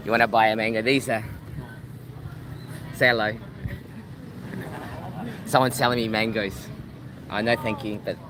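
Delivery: ordinary talk, nothing marked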